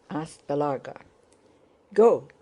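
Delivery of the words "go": loud driver's cry of GO; cried command. A narrator's reading. Driver starting the troika, loud cry. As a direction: call the horses off with a short, loud cry. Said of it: "he cried".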